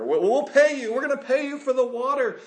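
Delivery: acted-out anxious-to-please offer; pleading appeal. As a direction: insist quickly that payment is coming. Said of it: "the people of Israel appeal".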